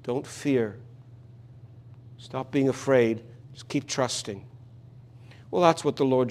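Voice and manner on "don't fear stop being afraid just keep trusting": soft, tender comfort; quiet, reassuring words of Jesus; gentle reassurance. Acted. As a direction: speak it like calming a frightened father. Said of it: "And Jesus says".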